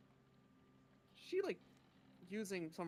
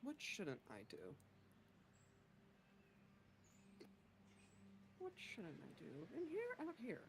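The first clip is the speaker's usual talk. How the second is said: softly thinking aloud; ruminating to herself; 3 semitones higher, 9 dB quieter than usual. Acted. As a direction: mull it over half to yourself, with long pauses. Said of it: "She thinks and ruminates to herself".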